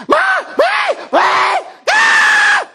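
very high, very slow, loud stretched wail of mommy please come; drawn-out wail. Retold or imitated. Pastor imitating his son's escalating bathroom wail, very high and slow. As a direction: wail it out like a desperate little boy. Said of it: "then he goes into this".

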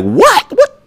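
very high, incredulous squeaks; shocked what. Retold or imitated. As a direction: squeal it in disbelief. Shocked by the bail amount.

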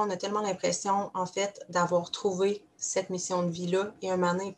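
ordinary delivery

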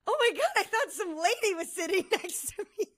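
high, breaking into laughter; re-enacted giggly disbelief; laughing at oneself. Telling friends about the imagined lady, laughing at oneself. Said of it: "I started laughing at myself".

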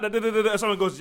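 ordinary talk, nothing marked

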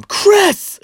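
high, loud call up the stairs; mom yelling name. Retold or imitated. Mom yelling up the stairs for her son. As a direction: belt the name from downstairs. Mom calls him from downstairs.

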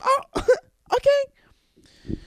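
very high laughing okay; imitating fiancee. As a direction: squeak it with a giggle. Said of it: "She's like".

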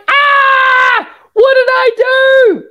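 high-pitched, drawn-out scream of dismay; comic panic. Acted. Coach acting out dismay at her own rebrand, comic shriek. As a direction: wail it loudly, hammed-up horror.

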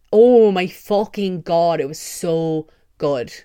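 loud, slow, drawn-out oh my God; excited gush. Real, not acted. Vlogger raving about the play, loud and slow. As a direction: let the awe spill out loudly and slowly.

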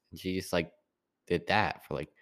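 ordinary talk

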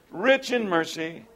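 slow, soft, with weight on Rich; tender emphasis on Rich; savoring the phrase. Shown as a demonstration. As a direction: linger on each word of Rich in mercy. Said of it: "What a beautiful statement".

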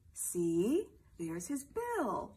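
bright, playful read-aloud voice; reading to a toddler. Acted. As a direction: read it like a bedtime picture book for little ones. Celebrity reads picture book to kids.

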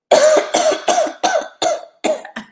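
loud choking cough; real coughing fit. Real, not acted. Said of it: "I am choking".

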